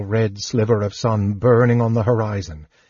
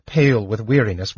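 plain reading voice

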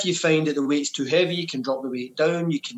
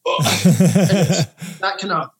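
slow, laughing through the words; chuckling as you speak; 6 dB louder, much slower than usual. Real, not acted. Laughing mid-sentence.

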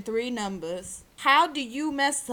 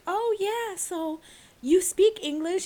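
mock interviewer; 7 semitones higher than usual. Mock interviewer to [name].

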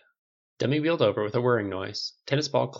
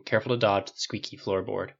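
usual speaking voice

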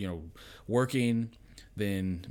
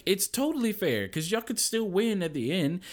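higher, louder dad voice; dad explaining; 11 semitones higher, 6 dB louder than usual. Retold or imitated. Dad to sore-loser kids, mock exasperated. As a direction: insist it's fair to the kids. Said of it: "I was like".

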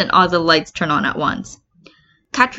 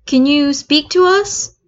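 higher, slow calling out; ghost hunter call; 8 semitones higher, 4 dB louder, slower than usual. Acted. Sarah calls to the ghosts with a spirit box, high and slow. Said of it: "Sarah asks".

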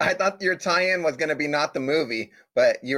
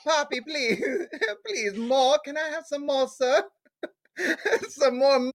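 begging orphan; 12 semitones higher than usual. Pretend. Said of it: "I was like".

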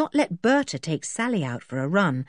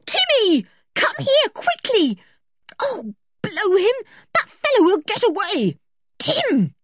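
high, loud, desperate shouts; frantic calling; 4 dB louder than usual. A narrator's reading. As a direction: yell for the dog before someone gets away. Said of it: "George called frantically again".

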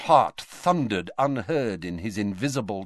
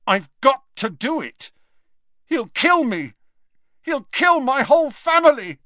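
much higher, louder, near breaking; desperate panic; 8 dB louder than usual. A narrator's reading. Terrified Malfoy confessing to the headmaster, high and desperate. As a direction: plead in rising terror.

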